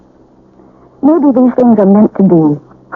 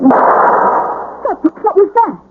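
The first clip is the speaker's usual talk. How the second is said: startled whisper; 13 semitones higher, much slower than usual. Acted. Startled by a gunshot.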